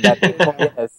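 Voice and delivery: laughing through a hammed-up Spanish accent; mock Spanish accent. Shown as a demonstration. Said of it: "I'll pronounce it in a Spanish accent".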